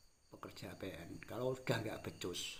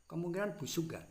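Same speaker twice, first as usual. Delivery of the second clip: angry; 8 semitones higher, 5 dB louder than usual. Real, not acted. Angry rant at officials.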